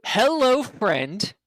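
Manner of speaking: high, broken-speech goblin; goblin plea. Acted. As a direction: ask politely like a strange goblin. GM goblin asks door opened.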